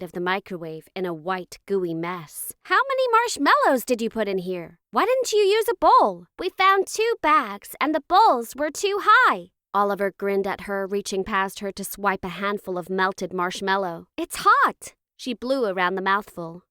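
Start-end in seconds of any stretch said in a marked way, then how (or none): 2.6-6.3 high, loud exasperated questions; exasperated mother. A narrator's reading. Mother groans at the gooey mess. 6.4-9.5 put-on high, slow, loud kid's voice; child explaining. A narrator's reading. Child explains the mess to mother. 14.2-14.9 put-on high, loud yelp of a kid; startled child's cry. A narrator's reading. Child swipes melted marshmallow.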